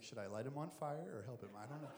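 quiet low God thinking aloud; musing God. Retold or imitated. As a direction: mutter it to yourself, deciding.

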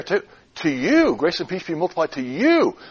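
long, falling emphasis on "you"; stress on YOU. Shown as a demonstration. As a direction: lean on "you" as the one receiving it. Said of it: "By emphasizing different words".

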